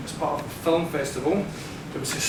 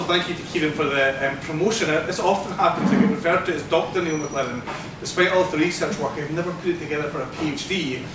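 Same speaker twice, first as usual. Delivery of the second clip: usual speaking voice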